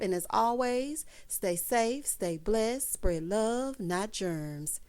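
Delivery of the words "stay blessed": ordinary delivery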